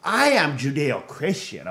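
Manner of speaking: indignant, drawn-out mock believer; lightly imitating a pious hypocrite; mocking leftist voice. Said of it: "these leftists, all".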